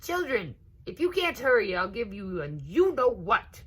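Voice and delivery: put-on mother calling her dawdling kids; scolding mother calling out. A narrator's reading. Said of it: "Missus Alligator called".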